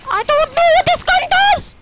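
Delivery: very high, loud scream. Real, not acted. Gamer baffled by a new gun, screaming. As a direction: scream every word at the top of your voice. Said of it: "Sorry if I'm screaming really loud".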